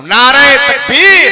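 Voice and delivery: loud, slow drawn-out rally call; slogan call. Real, not acted. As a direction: call the slogan out to the whole crowd. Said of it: "मैं नारा लगाता हूं".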